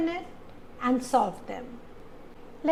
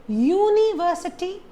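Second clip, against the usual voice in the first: university with the first syllable YU stretched and raised; misplaced stress; 7 semitones higher, 4 dB louder than usual. Shown as a demonstration. Teacher asks how you say it, stressing university on YU. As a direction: lean hard on the opening YU of university. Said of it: "You just have the stress on ver".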